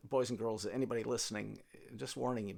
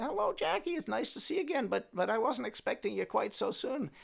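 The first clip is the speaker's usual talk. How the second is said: put-on old woman's voice; 7 semitones higher, 7 dB louder than usual.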